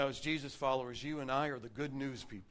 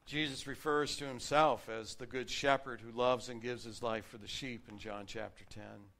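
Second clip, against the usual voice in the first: normal talking voice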